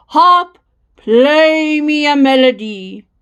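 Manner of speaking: loud, stretched-out giant's order; commanding giant. A narrator's reading. Giant ordering his harp to play, big commanding voice. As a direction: call it out like a giant giving orders.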